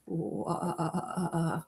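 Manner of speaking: low, soft ghostly moan; spooky voice. Acted. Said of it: "That's my spooky voice".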